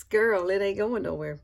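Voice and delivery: ordinary delivery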